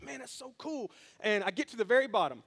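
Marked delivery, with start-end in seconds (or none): 0.0-0.9 soft, amazed murmur; hushed awe. Retold or imitated. Pastor quietly marvels at a friend's good-news text. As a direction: breathe it out in quiet wonder. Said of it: "I'm just like".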